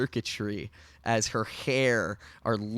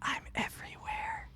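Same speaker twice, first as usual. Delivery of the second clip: high, airy, ghostly whisper; creepy whisper; 12 dB quieter than usual. Acted. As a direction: breathe it out eerily. The AI floats above them.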